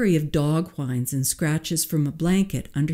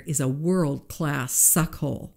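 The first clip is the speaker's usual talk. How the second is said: usual speaking voice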